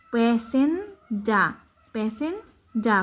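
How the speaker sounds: প্যাসেঞ্জা drawn out slowly; slow careful word. Shown as a demonstration. Teacher spelling out a borrowed word, slowly. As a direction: stretch প্যাসেঞ্জা syllable by syllable. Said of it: "তারপর দন্ত নয় বর্গীয় জ".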